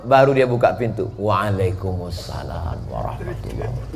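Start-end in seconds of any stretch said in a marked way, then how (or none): 1.1-3.9 much softer, lower answer; soft lowered reply. Retold or imitated. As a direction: jawaban pelan dan rendah dari balik pintu. Companion answers from behind door.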